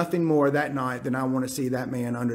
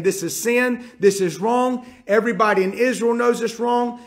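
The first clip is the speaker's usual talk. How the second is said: higher, urgent protest, pressed hard; earnest protest; 6 semitones higher, 3 dB louder than usual. Retold or imitated. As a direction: plead she's been wronged, higher and urgent. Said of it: "She is one hundred percent saying".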